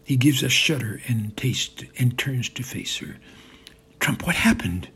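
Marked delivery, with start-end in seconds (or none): none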